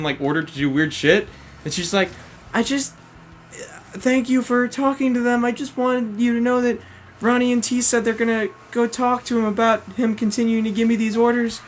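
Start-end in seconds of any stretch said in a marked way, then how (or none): 2.5-11.6 higher, soft, timid schoolgirl; higher, hushed, nervous girl asking for help. Retold or imitated. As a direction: plead meekly like a frightened student. Girl pleading to leave academy.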